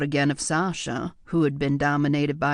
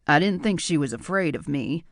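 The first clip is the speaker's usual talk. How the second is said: ordinary delivery, nothing marked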